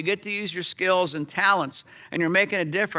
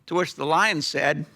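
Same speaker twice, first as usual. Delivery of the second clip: ordinary talk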